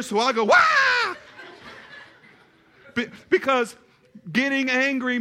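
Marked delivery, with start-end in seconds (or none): 0.4-1.1 very high-pitched comic shriek; answering startled scream. Retold or imitated. Son surprised by his mother on the porch, re-enacted scream. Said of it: "I didn't know she was there".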